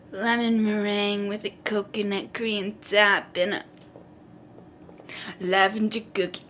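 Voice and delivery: lightly voiced man narrating desserts; a hint of a self-serious food reviewer. Man describing desserts into his phone, lightly imitated. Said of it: "He's like".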